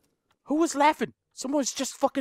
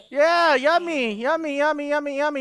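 mock coaxing; 4 semitones higher than usual. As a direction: coax a creature to eat. Feeding toy to monster.

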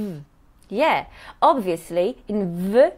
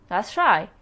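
usual speaking voice

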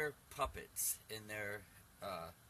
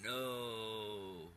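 a dark, otherworldly no; demonic no. Retold or imitated. Voice refusing the narrator's leaving, mock demonic no. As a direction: growl a single no like something old and evil. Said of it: "it was like the evil coming against me".

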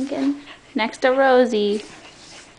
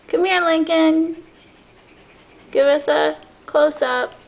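raised, louder coaxing call; calling a puppy; 7 semitones higher, 6 dB louder than usual. Real, not acted. Owner to Lincoln for a close-up, calling. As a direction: call the puppy over, high and loud. Calls puppy over to camera.

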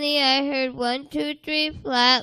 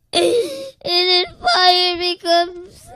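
usual speaking voice

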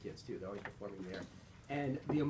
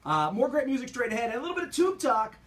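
higher, louder upbeat announcer read; radio tease; 8 semitones higher, 7 dB louder than usual. Acted. Radio host teases the next segment to listeners, upbeat. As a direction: sell what's coming up next.

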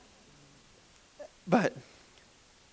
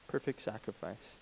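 much softer, repeated to himself; soft echo; 5 semitones lower, 15 dB quieter than usual. Shown as a demonstration. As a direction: let it sink in, nearly under the breath. Pastor echoes his own phrase.

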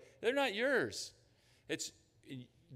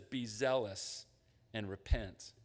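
slow emphatic quote; 4 semitones lower, slower than usual. Shown as a demonstration. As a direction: read the command slowly, each word weighted. Quoting Jesus, verse nineteen.

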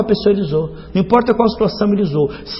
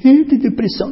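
mock sigh; 8 semitones higher, 3 dB louder than usual. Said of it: "não tava chorando de".

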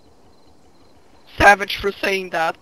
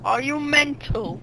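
softer, slow mock British accent; 5 semitones higher, 6 dB quieter, slower than usual. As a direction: say "Are you mental?" softly and slowly in a mock British accent. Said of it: "That's British".

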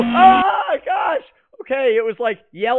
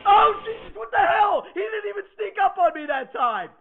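scared horror game streamer; 9 semitones higher than usual. Real, not acted. Jump scare.

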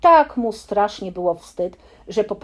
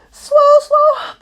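much higher, louder breathless stutter; breathless stammering; 12 dB louder than usual. Acted. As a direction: gasp out the broken word, too winded to finish. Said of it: "bełkotał prosiaczek, tak zdyszany".

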